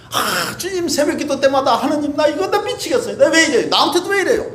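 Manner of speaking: high, crying plea to God; retold anguished prayer, voice breaking; tearful pleading prayer. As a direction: cry out to the Lord in distress. Retells crying out in dawn prayer.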